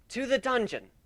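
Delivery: high, loud proclamation; royal command. Acted. Luna ordering the queen to the dungeon, loud and high. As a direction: call it out to the guards.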